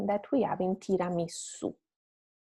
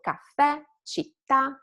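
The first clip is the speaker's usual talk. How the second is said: higher, with caffè and città lifted on the last syllable; rising final accent; 6 semitones higher, 3 dB louder than usual. Shown as a demonstration. Teacher shows students the wave, caffè, città stressed last. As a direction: punch the ends of caffè and città. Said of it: "and then rise at the end".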